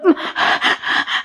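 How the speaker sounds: quiet unvoiced chuckle; soft breathy laugh. Real, not acted. Laughing at Middle East reciters. (tertawa pelan)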